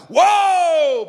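high, loud, long whoop; touchdown cheer. Shown as a demonstration. Preacher imitating a fan at a touchdown, roaring cheer. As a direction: erupt like a fan at a score. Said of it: "when our favorite team gets a touchdown".

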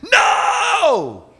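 long, high-pitched cry of warning; retold shout. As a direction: act out a desperate drawn-out shout. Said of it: "I was shouting".

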